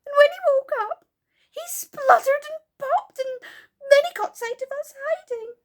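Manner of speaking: high, loud sobbing little fairy. Acted. Silky sobs to the children about Moonface, high and tearful. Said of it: "said Silky".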